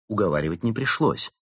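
usual speaking voice

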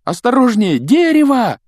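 very high, loud warning scream; yelling. A narrator's reading. Harry warns Ron before the car hits the tree, yelling. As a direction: scream the warning, rising to the last word. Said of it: "завопил Гарри".

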